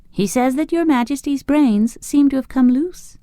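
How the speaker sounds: demure. A narrator's reading. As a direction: say it sweetly modest, lightly voiced. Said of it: "replied the girl demurely".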